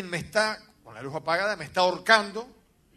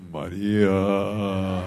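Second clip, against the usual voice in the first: much lower, very slow drawn-out name; eerie demon call; 10 semitones lower, 7 dB louder, much slower than usual. Retold or imitated. Demon voice on the phone calling a woman, low drawn-out call.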